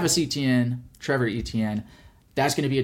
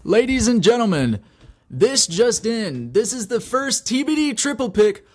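announcer voice; 8 semitones higher than usual. Pretend. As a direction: announce it like breaking news. Announcing the upset, game-show style.

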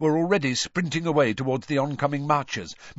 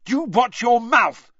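much higher, louder, slow furious shout; 12 semitones higher, 8 dB louder than usual. A narrator's reading. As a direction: yell a warning at him. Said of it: "shouted Ron".

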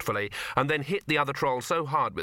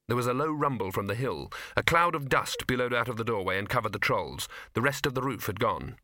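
plain reading voice

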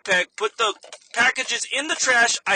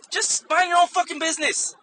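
a high, exasperated burst; exasperated outburst; 9 semitones higher than usual. Real, not acted. Venting to viewers about the meddling customer, exasperated outburst. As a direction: snap it out, fed up.

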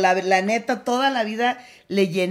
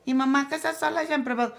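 put-on higher, quick little child's voice; a child's voice asking mom, higher and quick; 6 semitones higher, faster than usual. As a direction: play a curious small kid asking mom. Child asks mother a question.

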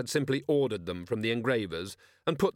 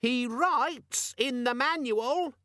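nasty squeaky demon. A narrator's reading. Demon exposing Vimes to the others, nasty squeak. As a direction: squeak it like a telltale. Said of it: "said the demon nastily".

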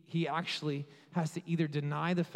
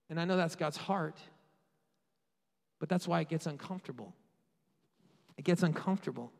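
usual speaking voice

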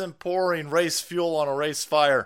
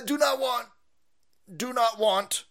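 disgusted; 7 semitones higher than usual. Real, not acted. Cat fur reaction, disgusted.

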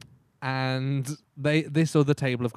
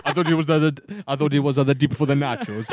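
put-on Italian accent; 5 dB louder than usual. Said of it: "that's what he said".

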